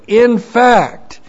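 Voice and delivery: "In fact" hit hard, high and loud; strong high-pitched emphasis on "In fact"; emphatic. Shown as a demonstration.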